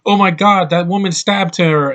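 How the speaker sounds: lightly imitated alarmed cry; mock alarm. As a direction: act out mild panic at a stabbing. Acts out reaction to a stabbing.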